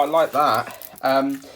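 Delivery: normal talking voice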